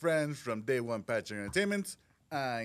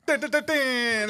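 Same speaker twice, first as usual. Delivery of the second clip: high, loud, slow bell chime imitation; mock bell ding; 11 semitones higher, 5 dB louder, slower than usual. Host chiming a bell over the news, high and slow.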